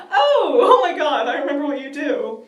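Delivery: high, loud, laughing mock panic; reenacted alarm. Panicked reaction to a Smiler.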